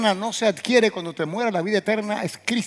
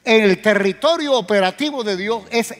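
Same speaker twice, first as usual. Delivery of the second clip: loud, higher, rising to a fast climax; preaching full force; 6 semitones higher, 8 dB louder than usual. Acted. Preacher hammering his point at the congregation. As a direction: preach it hard and faster at the end.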